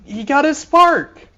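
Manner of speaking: high, loud, thrilled announcement; excited. Real, not acted. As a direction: burst out with real excitement. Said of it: "I'm so excited".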